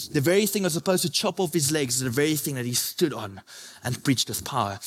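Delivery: ordinary delivery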